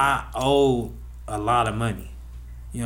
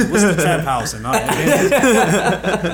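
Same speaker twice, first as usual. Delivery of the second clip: laughing; 5 semitones higher, 5 dB louder, much slower than usual. Retold or imitated. Teaching her slang, laughing.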